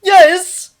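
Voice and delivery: high, loud, effortful yes; choking reply. A narrator's reading. Thomas answers the nurse while choking, strained. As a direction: force it out mid-cough. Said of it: "he managed and coughed again".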